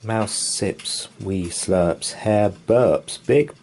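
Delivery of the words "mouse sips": plain reading voice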